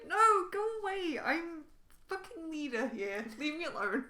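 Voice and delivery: high, loud mock outburst; hateful rejection. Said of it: "then she'll really hate you".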